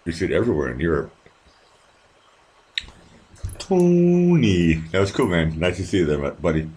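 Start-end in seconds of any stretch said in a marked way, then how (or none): 3.6-6.1 calling out. Real, not acted. Calling out to Tony.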